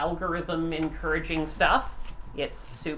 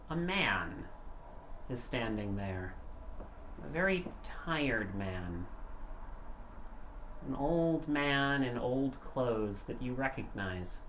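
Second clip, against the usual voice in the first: eerie narration; 5 semitones lower, 8 dB quieter than usual. A narrator's reading. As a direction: set a spooky scene softly. Game master's scene setting.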